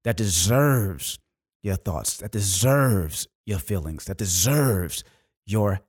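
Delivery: low, slow, weighty delivery; deliberate motivational close, pitched well down; emphatic closing exhortation. Real, not acted. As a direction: lean slowly on each key verb.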